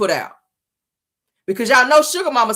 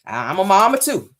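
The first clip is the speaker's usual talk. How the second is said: usual speaking voice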